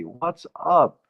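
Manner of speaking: ordinary talk, nothing marked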